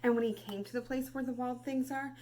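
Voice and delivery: put-on expressive read-aloud for children; storybook reading. Teacher reading Where the Wild Things Are to class, expressive.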